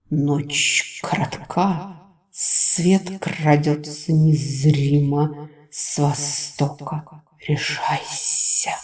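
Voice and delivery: very slow, hushed great serpent. A narrator's reading. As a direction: draw each word out like an ancient snake.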